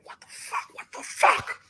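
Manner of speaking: voiceless, whispered yell building in force; whisper-scream it, second time much harder; hushed mock scream, swelling; stifled outrage. Retold outrage in the moment.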